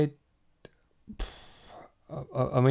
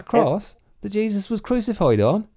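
high, incredulous questioning; mock disbelief; 11 semitones higher than usual. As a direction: feign awe at the holy nails.

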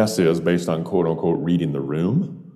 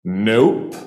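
curt refusal, higher with a rising snap; edge in her voice; 5 semitones higher, 4 dB louder than usual. Retold or imitated. Bartender refusing a stranger's order, blurted with an edge. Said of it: "blurted out with an edge to her voice".

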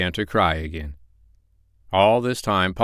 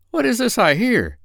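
high startled prince; startled cry; 3 dB louder than usual. Acted. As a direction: jump up in surprise. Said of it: "he cried".

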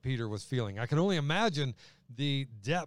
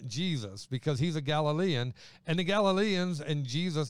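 ordinary delivery

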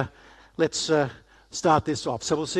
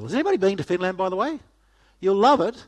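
ordinary delivery

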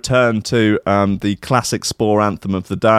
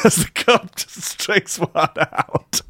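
high, slow, wheezing with laughter; cracking up mid-sentence; laughing through words; 8 semitones higher, 3 dB quieter, slower than usual. Real, not acted. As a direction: squeeze it out through giggles. Helpless laughter at his own joke.

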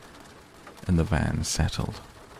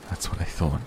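ordinary delivery, nothing marked

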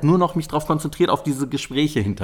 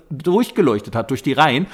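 ordinary delivery

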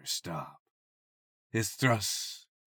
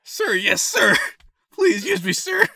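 much higher, louder squeaky pleading; mock begging; 8 dB louder than usual. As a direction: beg in a hammed-up girly squeak. Voicing the begging heroine.

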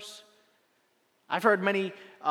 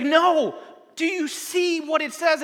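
higher, louder, slowed insistence; insistent excitement; 8 semitones higher, 6 dB louder, slower than usual. Retold or imitated. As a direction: make them really look.

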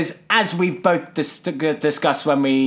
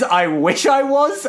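mock wishful woman; 11 semitones higher, slower than usual. Acting out a woman's line.

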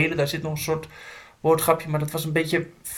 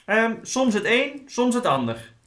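hesitant raccoon; 8 semitones higher than usual. A narrator's reading. Raccoon answers the shouting in story.

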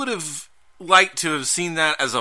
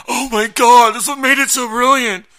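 gushing fan; 10 semitones higher, 8 dB louder than usual. Retold or imitated. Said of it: "I heard a lot of people going".

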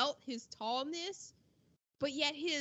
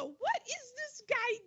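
baffled; 11 semitones higher, 3 dB quieter, much slower than usual. Retold or imitated. Bewildered quote of own reaction.

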